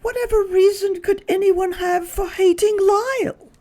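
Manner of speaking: dismayed Missus Prim. Acted. Missus Prim crying out in dismay at the note. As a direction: gasp it out in dismay. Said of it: "cried Missus Prim".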